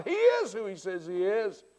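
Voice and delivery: ordinary talk, nothing marked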